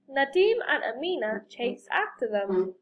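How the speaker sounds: usual speaking voice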